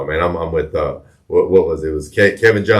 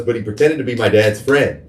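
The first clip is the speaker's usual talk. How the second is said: normal talking voice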